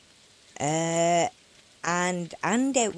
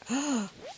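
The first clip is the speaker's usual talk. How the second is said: excited gasp; 11 semitones higher than usual. Real, not acted. Catching the eel.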